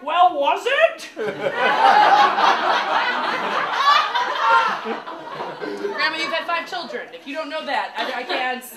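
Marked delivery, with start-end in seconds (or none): 0.0-1.1 loud, slow, higher grandma question; deadpan question. Retold or imitated. Grandma asking back loudly and slowly about the meat. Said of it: "And she's like". 1.1-5.9 loud, high laughter; big laugh. Real, not acted. Comedian laughing loudly at grandma's reply.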